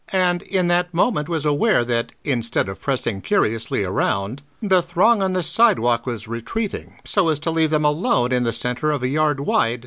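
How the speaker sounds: usual speaking voice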